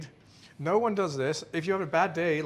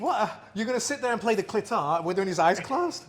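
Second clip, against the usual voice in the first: incredulous; 6 semitones higher, 4 dB louder than usual. Retold or imitated. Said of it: "And I'm like".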